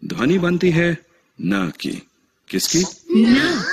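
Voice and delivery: rising to a loud high न; playful quiz. Acted. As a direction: tease the class, then spring the answer. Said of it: "teacher quizzing the class". (ऊँची, खिलखिलाती पहेली)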